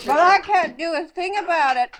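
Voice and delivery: exasperated. Real, not acted. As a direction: throw up your hands. Grandma exasperated.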